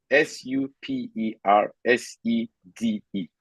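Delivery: S U P E R S E D E, each letter said separately and a little slowly; spelling it out. Shown as a demonstration. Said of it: "I spelled supersede the correct way".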